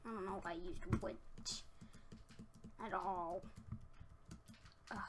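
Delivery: ordinary talk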